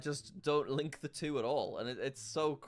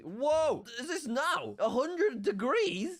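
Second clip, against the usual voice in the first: much higher and louder, slowing on the number, stunned; shocked; 14 semitones higher, 7 dB louder, much slower than usual. Real, not acted. Seeing a map with a hundred degrees, shocked and loud. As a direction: gasp at an unbelievable figure.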